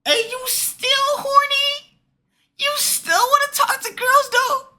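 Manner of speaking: very high falsetto disbelief; squeaky incredulity. Real, not acted. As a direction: squeal the questions slowly, stretched out. Mock disbelief at storyteller's behavior.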